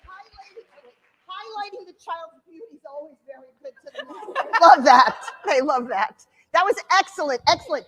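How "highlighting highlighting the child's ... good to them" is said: quiet amused aside; laughing, hushed. Real, not acted. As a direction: giggle through the words. Teacher mocks the answer, laughing.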